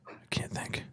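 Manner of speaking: whispered to self. Real, not acted. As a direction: whisper it under your breath. Trying to recall a word.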